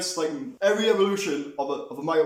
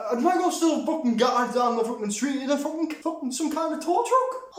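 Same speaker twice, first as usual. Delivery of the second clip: exasperated shout; 8 semitones higher than usual. Real, not acted. Ranting about absurd plot.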